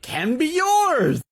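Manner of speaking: high, slow, loud preacher flourish; Armstrongist flair. Retold or imitated. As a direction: stretch it out like a showy televangelist. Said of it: "that Armstrongist flair when he was like".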